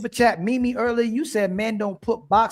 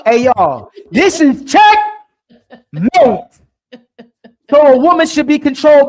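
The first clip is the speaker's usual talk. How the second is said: loud, high, slow gloating; triumphant shout; 7 semitones higher, 11 dB louder, slower than usual. Real, not acted. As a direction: crow over the win. Gloating checkmate call-out.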